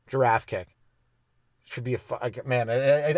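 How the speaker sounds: ordinary talk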